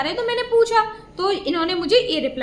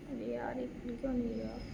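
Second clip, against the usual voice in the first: much softer, muttered to herself; quiet aside; 4 semitones lower, 15 dB quieter than usual. Real, not acted. Vlogger muttering at her ringing phone, very soft. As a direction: mutter at the phone, half under breath.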